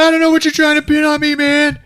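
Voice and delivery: high, fast, panicky denial; freaking out. Retold or imitated. Skip, shown murder photos, panicking at the cops. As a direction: rattle it off in a panic. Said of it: "he starts freaking out".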